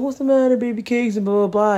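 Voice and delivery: high-pitched mock baby talk; imitated cooing dad. Dad to crying baby while gaming, imitated cooing. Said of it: "And he's like".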